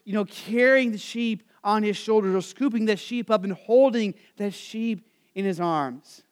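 ordinary delivery

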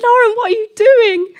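very high, loud mock panic; alarmed self-reproach. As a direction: squeal at yourself in comic alarm. Acting out alarm at her blurting.

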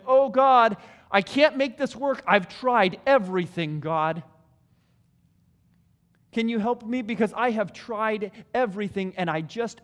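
loud, pleading cry to God; desperate plea. Retold or imitated. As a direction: beg God like you've run out of options. Desperate last-resort prayer voiced.

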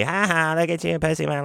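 high, jeering imitation of laughing friends; mocking laughter. Van mates taunting the sick storyteller, mock laughing jeer. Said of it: "they're laughing at me".

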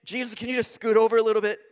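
fast, casual asking to make room; rushed pleading. Retold or imitated. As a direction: hurry the request past Jesus. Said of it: "the temptation is to say".

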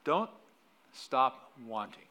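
usual speaking voice

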